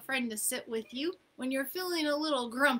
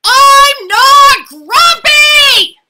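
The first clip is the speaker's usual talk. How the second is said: much higher, much louder, slow furious shout; 27 dB louder, much slower than usual. Acted. Jim yelling at the animals, furious shout. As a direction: blow up at everyone. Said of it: "shouted Jim as he beat his chest".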